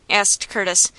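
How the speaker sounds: ordinary delivery, nothing marked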